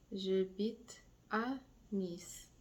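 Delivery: J'habite à Nice said slowly; slow model phrase. Shown as a demonstration. Teacher slowing the sentence for students. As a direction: say it slowly for learners. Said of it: "Now I'm going to say".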